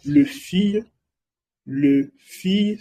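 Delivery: le fille modeled for the class; French demo. Shown as a demonstration. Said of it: "que significa la chica". (le fille como modelo de pronunciación)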